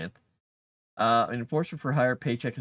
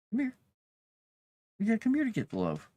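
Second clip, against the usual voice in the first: coaxing pet talk; 13 semitones higher, faster than usual. Real, not acted. Coaxing the cat.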